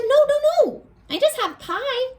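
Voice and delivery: high, squeaky begging ant voice; high little ant begging for its life; pleading ant. Acted. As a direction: plead like a tiny scared ant.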